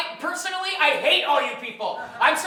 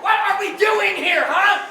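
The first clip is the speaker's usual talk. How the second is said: loud, high exasperated demand; exasperated outburst; 5 semitones higher, 6 dB louder than usual. Acted. Fed-up man confronts the whole stranded group, exasperated. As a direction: fire it out as an indignant challenge.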